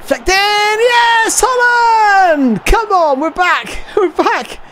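ecstatic shout. Real, not acted. Gamer celebrating the equaliser, ecstatic shouting. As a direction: scream it like the comeback is on. Equaliser scored in his game.

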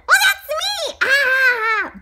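high, loud gleeful shout; delighted self-admiring cry. Acted. Donut Arnie admires himself, high delighted shout.

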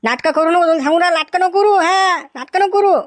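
high-pitched, threatening reprimand; angry scolding. Acted. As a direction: scold her hard as a warning. Father scolding daughter while beating her.

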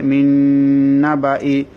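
"মিন নাবাই" with the n held and droned; correct recitation. Shown as a demonstration. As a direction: recite "মিন নাবাই" keeping baa short before the i. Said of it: "হবে".

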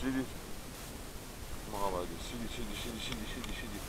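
soft, hushed stay command; talking to a dog. Real, not acted. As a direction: quietly keep the dog sitting.

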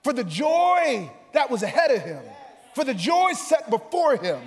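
full-force preaching. Real, not acted. Preacher proclaiming the joy before the cross, full-force preaching. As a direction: stretch it out, then climb to a shout.

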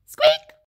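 sudden high squeaky peep; tiny squeak. Acted. Allosaur trying to roar, a tiny high squeak. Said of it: "roars a tiny squeak".

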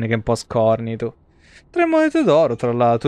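ordinary delivery

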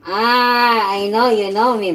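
loud, delighted teasing; excited. Real, not acted. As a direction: call it out loud and happy. Loud excited address to Ducky.

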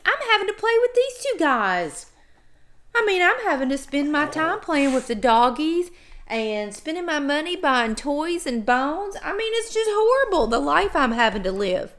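hammed-up woe-is-me complaint; sarcastic. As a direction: pretend it's such a hardship. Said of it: "I'm being totally sarcastic".

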